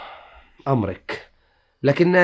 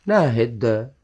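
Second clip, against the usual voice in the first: muttering name to himself; 6 semitones lower, 6 dB quieter, much slower than usual. Acted. Husband tries the name quietly.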